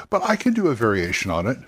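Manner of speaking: normal talking voice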